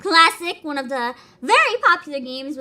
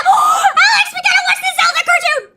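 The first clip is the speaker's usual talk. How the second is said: high, loud, fast excited yell; excited shout; 14 semitones higher, 7 dB louder than usual. Real, not acted. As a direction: burst out with giddy laughing excitement. Thrilled to find the Zelda cartoon.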